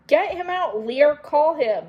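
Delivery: high, loud call to get the monkey out; urgent shout. Acted. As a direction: yell at Lear over the chaos. Sisters yelling at the monkey.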